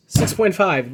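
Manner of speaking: ordinary talk, nothing marked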